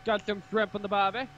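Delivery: put-on jokey Australian accent for a Space Marine chapter. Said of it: "That sounds more Aussie".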